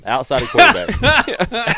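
slow, high, laughing repeat. Real, not acted. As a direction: laugh it out slowly. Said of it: "repeated correction".

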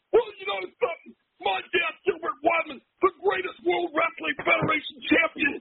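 proud eulogy, played up; grand eulogy. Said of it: "Man, I rocked the room".

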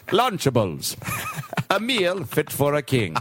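lightly voiced grand narrator tagline; solemn ad tagline; a hint of a grand British voiceover; Ian McKellen. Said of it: "Ian McKellen or Patrick Stewart comes on".

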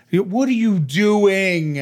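high, loud, slow disbelief; incredulous scold. Retold or imitated. Owner scolding her dog in slow, loud disbelief. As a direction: drag it out, amazed she's misbehaving. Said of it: "I'm like".

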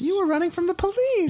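high, cheery farmer voice; farm parent. Acted. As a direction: chirp it out brightly. Farmer voice in role-play.

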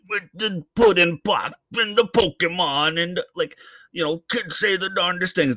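deliberately terrible Bill Cosby imitation; bad Bill Cosby impression. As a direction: ham up a clumsy Cosby. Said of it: "the worst Bill Cosby you ever heard".

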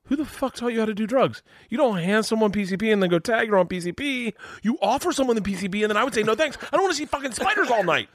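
high-pitched, exasperated protest; outraged rant. Retold or imitated. Comic scolding man who slipped him drugs, outraged. Retold outraged reply to drug trick.

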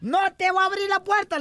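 high-pitched wife's refusal; refusing wife. Acted. Wife inside the house to drunk husband, high refusal. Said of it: "le dice la mujer adentro".

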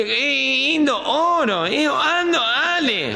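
slow, blurred consonants, dropped l's; gangoso imitation. Talking to the pet shop seller, in a mock gangoso voice. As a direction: mimic a man who speaks through his nose. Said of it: "el gang".

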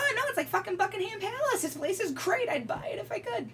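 hammed-up sales pitch praising a dump; fake nice. Photographer mocking how he sweet-talks sellers, mock gushing. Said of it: "I gotta be nice and be".

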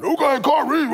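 mocking cohost's voice. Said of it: "I'ma do your voice like you try to do mine".

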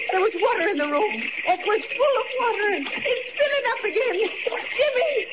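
rising panic, voice climbing high; panicked. Acted. Aunt sees the room flooding, panicked and high. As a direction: fear mounting as the water rises.

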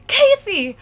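high, loud, drawn-out mock yell; exasperated boyfriend. Boyfriend calling across the theater, exasperated high yell. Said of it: "throws his hands in the air".

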